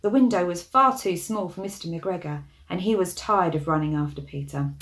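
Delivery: ordinary delivery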